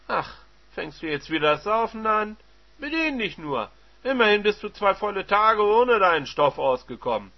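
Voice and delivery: high, loud sarcasm; taunting husband. Acted. Husband taunting his wife about her drinking, loud.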